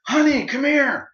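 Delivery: loud, high, drawn-out call across the house. Retold or imitated. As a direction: call your husband from the next room, slowly. Wife calling from another room.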